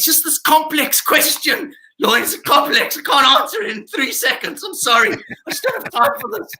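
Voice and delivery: high-pitched, laughing plea of defeat; mock overwhelmed. Comedian begging off a complex question, mock overwhelmed. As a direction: play flustered, laughter breaking into the words.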